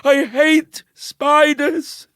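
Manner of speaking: high, loud, broken stammer into a cry; panicked boy stuttering about spiders; terrified stutter. Acted. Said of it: "Bernie sat there stuttering".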